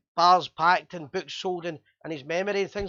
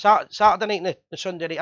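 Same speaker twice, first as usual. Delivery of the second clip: usual speaking voice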